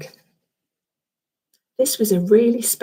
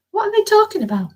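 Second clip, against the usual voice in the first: curious question; 12 semitones higher, faster than usual. Real, not acted.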